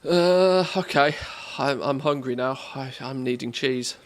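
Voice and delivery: normal talking voice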